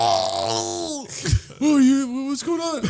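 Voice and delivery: high, put-on startled voice; mock startled partner. Imagining the friend purring in bed, mock startled reply. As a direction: play it as a surprised lover. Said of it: "I'd be like".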